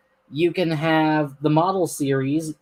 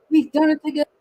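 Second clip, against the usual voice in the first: much higher, excited cry; triumphant engines; 12 semitones higher, 4 dB louder, faster than usual. Acted. Edward and Henry cheering after moving the coaches, high excited. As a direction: celebrate the win out loud. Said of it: "said Edward and Henry".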